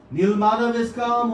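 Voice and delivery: calling out. Retold or imitated. King's men announce to the hiding devotees, loud call. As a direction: proclaim it so the hidden ones hear.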